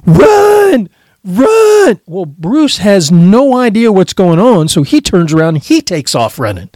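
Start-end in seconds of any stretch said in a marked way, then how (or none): 0.0-2.0 high frantic shout; shouting to his friend to flee; yelling run. Retold or imitated. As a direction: yell it in a panic, running. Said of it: "and I'm yelling".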